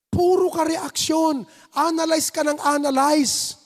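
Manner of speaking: usual speaking voice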